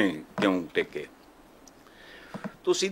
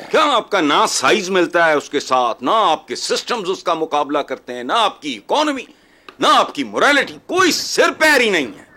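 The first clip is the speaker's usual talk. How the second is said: raised, higher voice, indignant; exasperated outburst, real; heated rant; 4 semitones higher, 5 dB louder than usual. Real, not acted. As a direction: fire off each objection with rising force. Heated rant dismissing the comparison.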